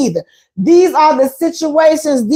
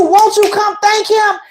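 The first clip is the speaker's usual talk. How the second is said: higher-pitched, insistent restating; emphatic relayed invitation; 5 semitones higher than usual. Retold or imitated. Warning the woman about his real meaning, high and insistent. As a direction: spell out his hint, pitched up. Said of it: "He telling you".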